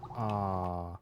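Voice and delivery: usual speaking voice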